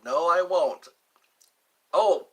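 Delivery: ordinary delivery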